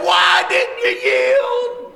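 high, loud, stretched-out question; exasperated yell. Retold or imitated. Father to son after missed yield sign, exasperated yell. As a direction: holler it slowly at your son in disbelief.

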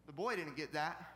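normal talking voice

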